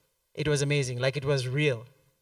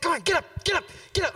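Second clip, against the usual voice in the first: mock urging; 10 semitones higher, much faster than usual. Pastor urging sick man up.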